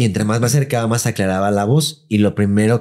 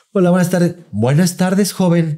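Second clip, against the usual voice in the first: service-mode politeness; 7 semitones higher, slower than usual. Retold or imitated. Scene: housekeeper greets the boss sweetly, in service mode. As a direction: greet like a humble maid. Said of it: "pero ya en modo servicio".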